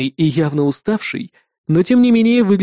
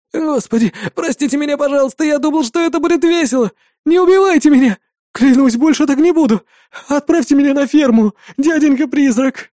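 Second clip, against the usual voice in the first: much higher, loud desperate begging; terrified pleading; 9 semitones higher, 3 dB louder than usual. Acted. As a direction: beg for your life, hysterical. Terrified boy begs for life. (истеричная мольба, высоко и громко)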